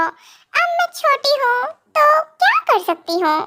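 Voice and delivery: high, slow, drawn-out complaint; sulky protest. Acted. Wife complaining she can't help being small, protesting.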